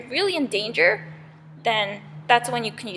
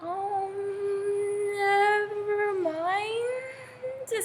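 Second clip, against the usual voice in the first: high, very drawn-out retreat; nervous backing off; 9 semitones higher, 4 dB quieter, much slower than usual. Acted. Bees seeing angry Zeus, nervously backing off. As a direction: stretch it out slowly while shrinking away. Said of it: "they see how angry he is".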